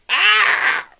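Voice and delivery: very high, loud villain scream. A narrator's reading. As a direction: shriek in terror. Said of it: "Why the scream?".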